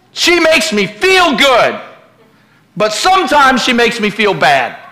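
loud, high-pitched pulpit preaching; full-force preaching. Real, not acted. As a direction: ring it out to the congregation.

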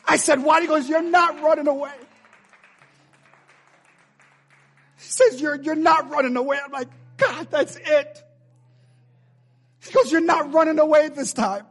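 high, loud, drawn-out declarations; impassioned sermon climax at full voice; full-force preaching. Real, not acted. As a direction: proclaim it to the whole church.

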